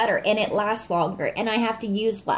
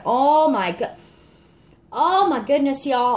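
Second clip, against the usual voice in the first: higher and louder, bursting with delight; excited exclamation; 5 semitones higher, 5 dB louder than usual. Real, not acted. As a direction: blurt it out, can't contain yourself. Overwhelmed by the scent.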